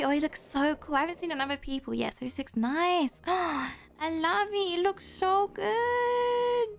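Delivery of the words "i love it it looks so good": high, very drawn-out squeal of approval; slow, high, delighted gush. Real, not acted. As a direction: gasp and coo over how good it looks. Admires fan wearing her merch.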